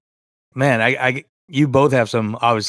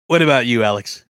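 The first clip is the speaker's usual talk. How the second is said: usual speaking voice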